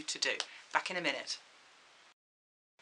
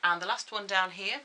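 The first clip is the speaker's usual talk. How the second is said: ordinary talk, nothing marked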